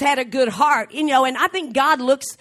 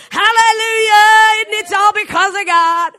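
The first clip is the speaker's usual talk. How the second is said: full-force preaching; 5 semitones higher, 4 dB louder than usual. Real, not acted. Preacher testifying to the glory of God, full-force preaching. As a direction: preach it out in relentless, raised phrases.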